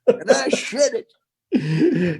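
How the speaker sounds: put-on high, very slow old-timer drawl; old prospector voice. In the old-timer podcast bit, a slow prospector drawl. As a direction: drag it out like a prospector. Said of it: "did it that way?".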